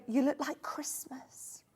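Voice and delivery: slow whisper, imitating a young girl; whispered quote. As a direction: quote her in a drawn-out whisper. Retells young girl's remark.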